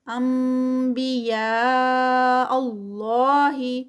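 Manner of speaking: انبیاء with the nun turned to m, very slow; iqlab recited. Shown as a demonstration. Teacher reciting the phrase for students, slow and chanted. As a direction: chant انبیاء اللہ slowly, nun becoming m before ba. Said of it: "تو یہاں پر اقلاب".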